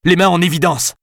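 high, loud command; shouting. A narrator's reading. Alice holds him at gunpoint.

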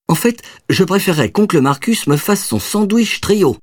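put-on high, pleading child voice; little boy. A narrator's reading. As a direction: ask sweetly like a kid. Child asks for sandwich. (voix d'enfant qui réclame)

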